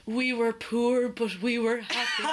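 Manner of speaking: slow hammed-up parent voice. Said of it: "I love this one".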